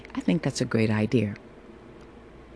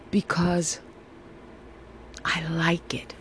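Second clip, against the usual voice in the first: whispered; 2 semitones higher, 6 dB quieter than usual. Real, not acted. Quiet personal confession.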